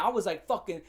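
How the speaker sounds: normal talking voice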